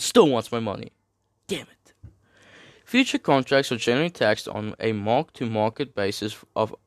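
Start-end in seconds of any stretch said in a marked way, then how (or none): 1.5-1.9 softer, higher grumbled curse; quiet little outburst of annoyance; muttered mock curse. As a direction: curse to yourself in mock frustration. Repeats curse under breath about taxes.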